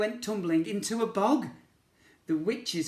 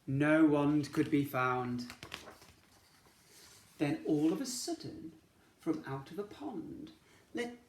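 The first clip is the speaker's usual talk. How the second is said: plain reading voice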